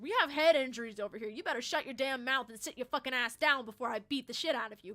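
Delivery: loud, heated telling-off; angry scolding. Retold or imitated. Retold threat to yelling woman after crash, angry scolding.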